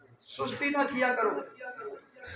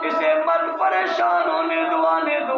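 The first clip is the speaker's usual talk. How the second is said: higher, louder fervent plea; impassioned preaching; 5 semitones higher, 7 dB louder than usual. Acted. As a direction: plead fervently on the saint's behalf. Voicing the prophet's plea, preaching.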